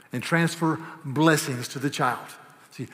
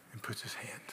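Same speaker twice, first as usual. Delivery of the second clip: whispered hush; 5 semitones lower, 17 dB quieter than usual. Real, not acted. Soft close of thought.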